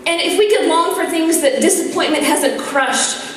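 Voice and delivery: slow, searching preacher voice with rising questions; inspirational preaching. Acted. Nun urging the audience to dream, slow heartfelt preaching.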